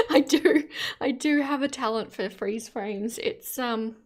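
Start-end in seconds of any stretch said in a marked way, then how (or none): none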